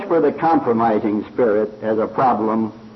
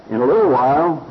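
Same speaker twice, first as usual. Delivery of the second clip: ordinary talk, nothing marked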